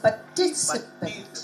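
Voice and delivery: "Participant" slow and broken up, par-ti-ci-pant; slow, quiet breakdown of "Participant"; syllable by syllable. Shown as a demonstration. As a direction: softly pick apart the syllables of "Participant". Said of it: "maybe I'm not doing it right".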